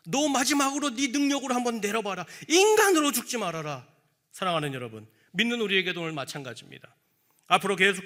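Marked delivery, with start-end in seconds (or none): 0.1-3.8 higher, louder sneering dare; taunting devil. Acted. The devil goading Jesus on the cross, sneering and loud. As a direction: goad him to save himself. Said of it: "마귀는 포기하지 않았다는 거예요".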